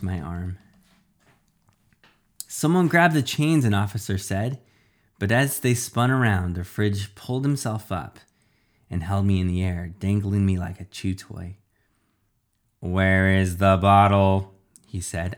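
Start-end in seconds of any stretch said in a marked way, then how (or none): 2.5-3.6 high, urgent command; called-out order. Acted. Officer calls out an order. 12.8-14.5 slow, loud villain demand; refrigerator villain. Acted. Villain interrogates captive.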